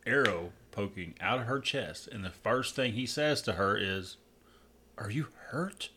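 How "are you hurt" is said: breathy hushed whisper, voice nearly gone; whispered question. Retold or imitated. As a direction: whisper it like checking on someone hurt. Retelling hero's gentle movie line.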